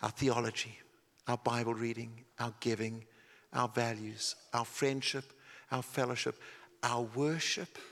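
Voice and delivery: hushed sincere sermon voice; quiet, earnest preaching. Acted. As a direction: draw them in close and gently. Quiet intimate sermon section.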